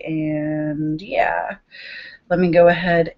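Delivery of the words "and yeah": whisper. Real, not acted. Trailing aside to herself.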